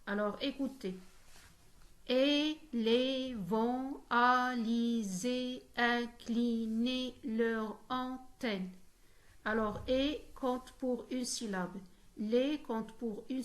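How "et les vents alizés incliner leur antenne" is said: slow, each syllable held apart. Shown as a demonstration. Teacher demonstrating the twelve-syllable alexandrine, syllable by syllable. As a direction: split the line into separately voiced syllables. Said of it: "comporte douze syllabes prononcées".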